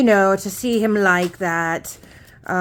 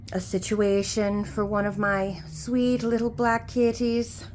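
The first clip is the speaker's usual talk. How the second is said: Lou's light Scottish-Irish lilt, mostly softer; Irish or Scottish accent; 4 dB quieter than usual. Acted. Lou telling viewers about her sick kitty in a Celtic lilt. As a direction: talk it through in a gentle Celtic accent. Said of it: "sounding a little bit more Irish or Scottish".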